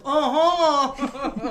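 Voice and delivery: loud, high imitated exclamation; excited whoop. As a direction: whoop it out, tipping into laughter. Imitating excited trampoline reaction.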